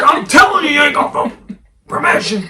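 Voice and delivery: angry shout. Retold or imitated. Landowner yelling at Christina. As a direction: yell it at her. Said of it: "He's like".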